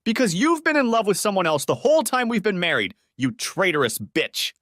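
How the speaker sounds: high furious outburst, slowing on the insult; exploding in anger. A narrator's reading. Husband explodes at cheating wife, a high angry outburst. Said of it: "I exploded at her".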